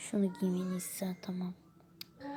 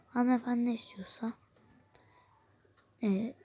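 normal talking voice

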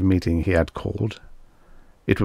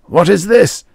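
high, loud frightened quaver; 12 semitones higher, 8 dB louder than usual. Acted. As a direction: voice shaking with fright. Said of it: "the producer's voice quavered".

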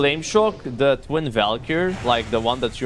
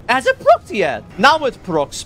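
much higher and louder, eager; excited; 9 semitones higher, 5 dB louder than usual. Real, not acted. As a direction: squeal with impatient excitement. Waiting eagerly for the proc.

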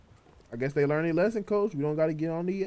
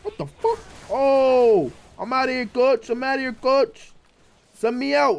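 higher and louder mock exasperation; hammed-up outburst; 7 semitones higher, 5 dB louder than usual. Real, not acted. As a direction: overplay the frustration. Dramatic reaction after being killed.

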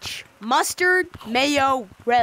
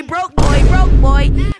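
loud taunt; 11 dB louder, much slower than usual. Real, not acted. Taunting another player.

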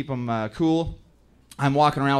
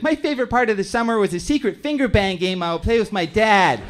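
put-on higher, louder proud child voice; innocent kid; 6 semitones higher, 5 dB louder than usual. Son's innocent class answer.

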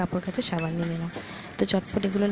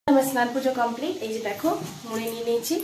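ordinary delivery

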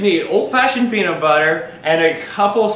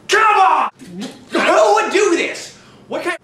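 frustrated shout; 8 semitones higher than usual. Acted. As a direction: shout at the jar in disbelief. Shouting at stuck jar.

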